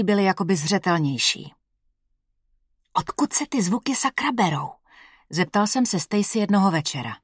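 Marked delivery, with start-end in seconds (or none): 2.9-4.7 hushed, voiceless question in the dark; whisper. Acted. Narrator whispering to his fiancée in the dark. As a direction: whisper it so as not to wake anyone. Asks at night beside sleeping fiancée.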